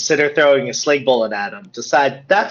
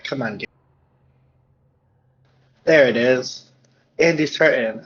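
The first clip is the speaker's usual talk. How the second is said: ordinary talk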